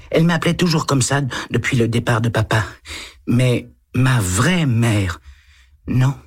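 put-on a small boy answering a policeman shyly; little boy. A narrator's reading. Eight-year-old boy answers the lieutenant, timid child voice.

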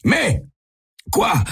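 high-pitched, halting disbelief; bewildered stammer. A narrator's reading. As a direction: stammer in bewilderment, each word popping up high. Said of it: "articule le vieil homme aveuglé".

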